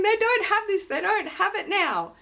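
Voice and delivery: high, loud, eager protest; excited insisting. Retold or imitated. Retold protest to the sales assistant, high and excited. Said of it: "I was going".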